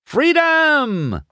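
very high, loud, drawn-out cry; jubilant shout. A narrator's reading. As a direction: shout it to the skies. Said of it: "McQueen shouted loudly".

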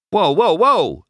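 loud, high startled whoa; startled race car yelps. Acted. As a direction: jump at a sudden touch. Startled by someone touching him.

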